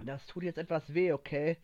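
plain reading voice

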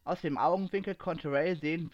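usual speaking voice

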